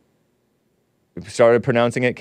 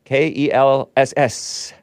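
ordinary talk

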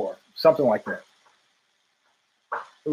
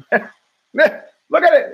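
drawn-out laugh through the words; giggling at the monkeys on screen; 8 semitones higher, 5 dB louder, much slower than usual. Real, not acted. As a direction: laugh it out slowly, pitched up.

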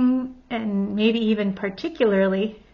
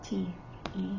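ordinary talk

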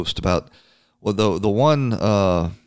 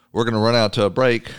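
announcer voice. Acted. Host announces break.

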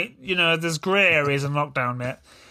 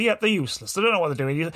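usual speaking voice

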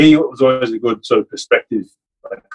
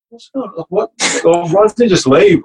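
baffled high mock exasperation; 9 semitones higher than usual. Player baffled why the coach keeps hanging around, high exasperated voice. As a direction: act out the confused inner voice, much higher. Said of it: "I was like".

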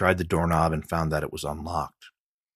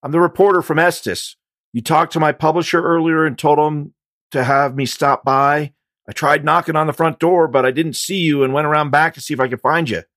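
nervous raised voice; 10 semitones higher, 9 dB louder than usual. Acted. Explaining fast at gunpoint.

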